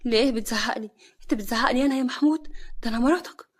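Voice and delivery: loud, high indignant retort; angry. A narrator's reading. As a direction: shout back as an affronted wife. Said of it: "نوال بغضب".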